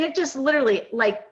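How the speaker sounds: ordinary talk, nothing marked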